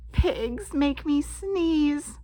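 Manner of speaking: usual speaking voice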